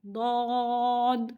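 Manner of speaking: ضاد held long from the tongue side; drawn-out Dhad. Shown as a demonstration. Teacher demonstrating ضاد slowly to students. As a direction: stretch ضاد slowly, tongue edge on the molars. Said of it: "اسے پڑھنا ہے".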